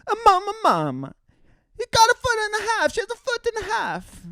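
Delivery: put-on very high-pitched squealing kid; excited child. Child running downstairs to tell Mama, very high excited squeal. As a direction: squeal it like a frantic little kid.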